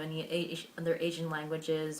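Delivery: usual speaking voice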